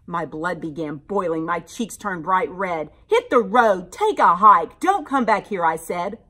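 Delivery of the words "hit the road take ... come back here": put-on loud angry kid shout. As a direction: yell him out of the room. Blood boiling, yells monster away.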